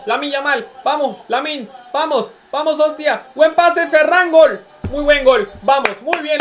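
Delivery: high, loud live cheering; excited cheering. Real, not acted. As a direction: cheer the goal as it happens.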